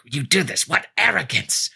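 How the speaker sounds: high, mocking villain taunt; a hammed-up villain scoffing at the thief. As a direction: sneer it out in a high put-on voice. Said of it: "he's like".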